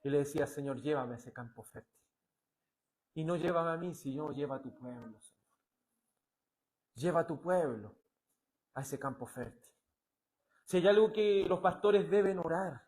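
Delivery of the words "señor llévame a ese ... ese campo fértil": soft, low prayer to the Lord; hushed plea. Acted. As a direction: plead quietly on the people's behalf. Voices the prophet's humble prayer. (súplica en voz baja al Señor)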